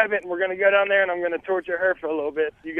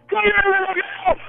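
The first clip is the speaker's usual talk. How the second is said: maniac haunt actor; 11 semitones higher than usual. Acted. Haunt actor luring a visitor, manic high shout. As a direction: gleefully menace the scared guests.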